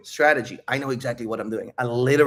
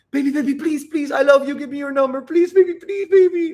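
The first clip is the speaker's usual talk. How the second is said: very high, loud needy pleading; hammed-up squeal of neediness, climbing higher; mock desperate begging; 14 semitones higher, 6 dB louder than usual. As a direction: beg like a hopelessly clingy suitor. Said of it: "that's the energy they approach with".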